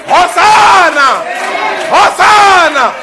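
slow, high ringing shout of praise; crowd's shout. Retold or imitated. Crowd hailing the king on the donkey, shouting. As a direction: cry it out to the top of your voice. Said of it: "every person was screaming at the top of their voices".

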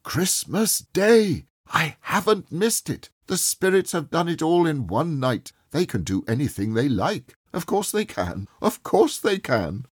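high, eager relief; delighted old man learning it's Christmas. A narrator's reading. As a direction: marvel gleefully. Scrooge delighted it's not over.

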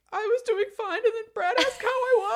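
high, loud laugh-cry retelling; half laugh, half sob; crying through a laugh. Retold or imitated. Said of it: "I just started sobbing".

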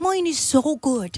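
slow, put-on Irish accent; hammed-up Irish accent; mock Irish accent. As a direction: boast in a drawn-out Irish brogue. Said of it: "'Cause yours is not real".

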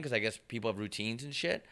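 ordinary delivery

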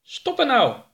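very high, sharp alien snap; snapping alien. Acted. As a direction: bark it at the blinking alarm. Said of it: "snauwde Oroka".

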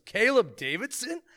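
mock astonished, high and drawn out; surprised recognition. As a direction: call the name in slow, rising disbelief. Old acquaintance recognizes him in bar.

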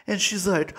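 usual speaking voice

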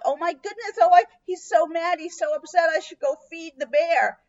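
high-pitched, flustered gasp of alarm; shocked. Pretend. Shocked homeowner fretting over the angry bear, high and flustered. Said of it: "You're, like, shocked".

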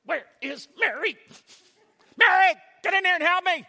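loud, high, exasperated calling for Mary; angry call. Retold or imitated. Martha yelling for her sister to come help. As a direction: yell across the house for your sister.

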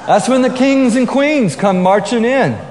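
high, loud, pompous heralding of royalty; mock-grand proclamation. Preacher teasing the Sunday lunch crowd as royalty, mock-grand proclamation. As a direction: announce them with exaggerated pomp, stretching it out.

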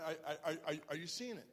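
loud, high, rising sermon climax; fiery pastor driving the sermon home; full-force preaching. Acted. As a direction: preach it hard to the congregation.

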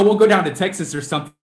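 ordinary talk, nothing marked

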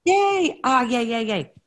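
high, loud yay, then drawn out; delighted cheering. Real, not acted. Host greets a guest finally joining the call, delighted cheering. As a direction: cheer a friend's arrival with glee.

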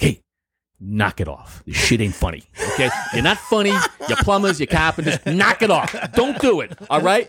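retold cop telling someone off in a heavy New York accent. New York cop scolding TV plumbers, heavy accent. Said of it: "in the heavy accent".

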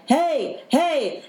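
high urgent calls after her; calling out. Retold or imitated. Man calling after her as she flees, high urgent hey. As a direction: shout after a runaway.

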